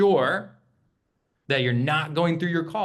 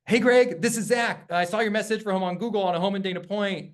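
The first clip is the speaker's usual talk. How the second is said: rushed over-eager pitch; 7 semitones higher than usual. Shown as a demonstration. Agent rushing the opener without waiting, eager and fast. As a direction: blast through without a pause. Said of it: "it's really awkward when you go".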